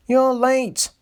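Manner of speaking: sharp high snap; snapped. A narrator's reading. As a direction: bite the words off, annoyed. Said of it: "he snapped".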